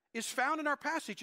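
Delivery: ordinary talk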